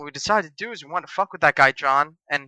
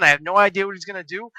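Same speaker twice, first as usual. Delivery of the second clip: ordinary delivery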